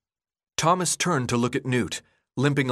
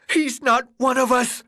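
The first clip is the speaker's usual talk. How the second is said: high, frantic shout; 13 semitones higher, 5 dB louder than usual. Acted. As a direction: yell the accusation at the crowd. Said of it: "Ben shouted".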